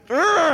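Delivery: frustrated groan. Retold or imitated. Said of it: "And I'm just".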